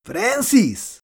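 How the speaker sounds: a high, ringing call of his brother's name; stumbling out of the kitchen, Tommy yells for his brother; shouting a name. A narrator's reading. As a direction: call out to Francis across the house. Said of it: "kiáltotta".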